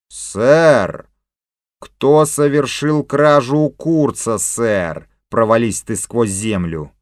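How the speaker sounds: loud, slow indignant echo; angry mocking repeat. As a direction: throw the question back angrily. King mockingly repeats, curses chief.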